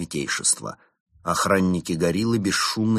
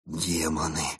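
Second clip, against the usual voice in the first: terrified whisper; 3 semitones lower, 4 dB quieter than usual. A narrator's reading. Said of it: "с ужасом прошептал Константин".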